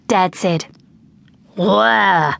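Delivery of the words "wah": put-on drawn-out gorilla grunt from a boy; gruff gorilla noise. A narrator's reading. Boy in gorilla suit answers his dad, gruffly. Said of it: "answered in his gruffest voice".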